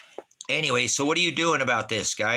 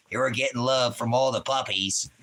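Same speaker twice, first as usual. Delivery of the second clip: ordinary talk, nothing marked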